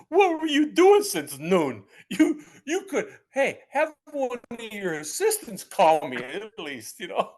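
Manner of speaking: high, loud comic rant; hammed-up indignant complaint, voice way up; retold exasperation. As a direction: vent in mock outrage at being kept waiting. Silently rants at late caller.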